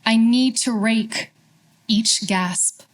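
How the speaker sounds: measured poetry reading with long pauses between lines; unhurried, deliberate verse, falling at line ends; poem recitation. Acted. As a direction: recite it line by line, letting each phrase settle.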